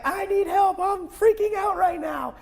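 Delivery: high, strained cry for help; panicked yell. Retold or imitated. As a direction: plead like you're losing control. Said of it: "Now I'm yelling".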